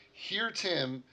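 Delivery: normal talking voice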